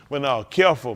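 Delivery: usual speaking voice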